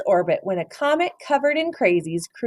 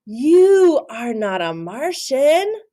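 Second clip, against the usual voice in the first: slow, higher, louder insistence; insistent; 7 semitones higher, 5 dB louder, much slower than usual. Acted. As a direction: tell him firmly. Character version.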